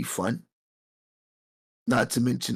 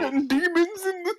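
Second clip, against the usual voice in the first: laughing. Real, not acted. Joking about demons.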